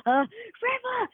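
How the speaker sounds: mild mock of a teacher mum; imitating mum. Boy imitating his dance-teacher mum urging him on.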